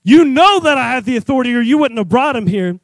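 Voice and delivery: ordinary talk, nothing marked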